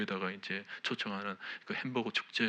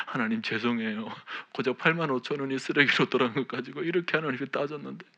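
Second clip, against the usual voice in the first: retold tearful repentance; 4 semitones higher, 5 dB louder than usual. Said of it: "정말 또다시 눈물을 흘렸습니다".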